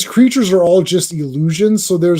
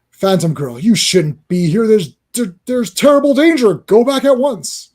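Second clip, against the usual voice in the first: urgent warning voice; 4 semitones higher, 3 dB louder than usual. Acted. Creature warns Phantom Girl.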